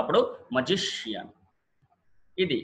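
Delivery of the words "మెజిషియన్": మెజిషియన్ with GI stressed, ending as one syllable; penultimate stress. Shown as a demonstration. Teacher explains one-syllable ending, magician stressed on GI. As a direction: stress GI in మెజిషియన్, drop on the end. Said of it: "ఒకటే syllable".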